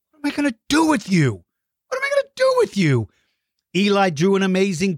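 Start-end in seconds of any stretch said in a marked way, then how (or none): 0.1-3.1 fast, high-pitched comic despair; mock exasperation. Host to mischievous young fans, mock exasperated. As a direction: play exasperated, quick and squeaking upward.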